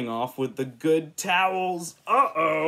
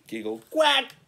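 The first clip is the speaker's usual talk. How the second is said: duck quack; 10 semitones higher, 4 dB louder than usual. Acted. Animals giggling and making noises.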